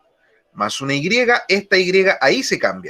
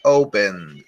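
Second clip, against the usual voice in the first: opened repeated lower, falling; 8 semitones lower than usual. Shown as a demonstration. Teacher repeating opened low for the class. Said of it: "Open, abrir, opened".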